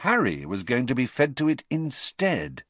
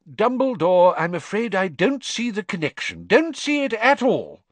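higher, blustering official tone; a fussy minister dismissing concerns; pompous minister; 12 semitones higher, 4 dB louder than usual. A narrator's reading. Fudge's voice in the reading.

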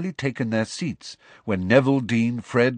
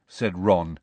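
ordinary delivery, nothing marked

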